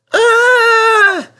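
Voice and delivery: high, loud, drawn-out cry of dismay; loud groan. Acted. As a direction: cry out as the crash hits. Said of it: "groaned Percy".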